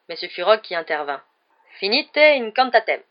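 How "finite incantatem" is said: loud, slow, higher-pitched spell; exclaimed incantation. A narrator's reading. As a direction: proclaim the counter-spell slowly and forcefully. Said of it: "s'exclama-t-il". (incantation exclamée, lente et forte)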